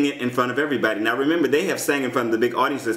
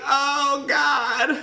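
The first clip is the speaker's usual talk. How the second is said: shrieking jackpot winner; 14 semitones higher than usual. Real, not acted. Distraught over erased notes.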